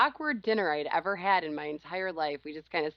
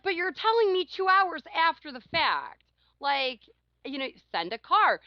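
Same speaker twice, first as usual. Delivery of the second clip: high, exasperated complaint; indignant retort; 7 semitones higher, 3 dB louder than usual. Retold or imitated. Telling off the absent client, exasperated. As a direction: protest that he should have sent a car. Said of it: "I'm like".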